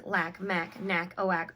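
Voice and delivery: usual speaking voice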